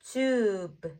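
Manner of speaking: "Tube" stretched slowly with a long vowel; drawn-out blend. Shown as a demonstration. Teacher blends sounds slowly first.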